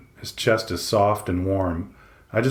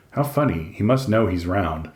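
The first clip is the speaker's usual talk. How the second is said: usual speaking voice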